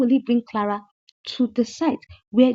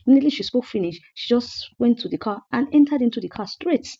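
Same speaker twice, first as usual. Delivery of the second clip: usual speaking voice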